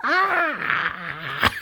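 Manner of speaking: sudden high squeal of glee, a touch real; excited squeal. Retold or imitated. Vlogger reacting to the giggling couple, high squeal. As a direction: squeal like you just saw the kiss. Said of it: "And I was like".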